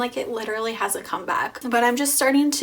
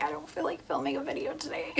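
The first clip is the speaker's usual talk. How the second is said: groggy sick voice; 7 semitones lower, 10 dB quieter, faster than usual. Retold or imitated. Quoting sick tired self.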